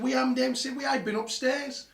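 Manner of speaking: high, loud denial; retold protesting shout. Son downstairs answering dad upstairs, shouted protest. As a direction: call back up in protest.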